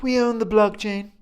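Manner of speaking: hammed-up boastful superpower retort; mock America. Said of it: "America's like".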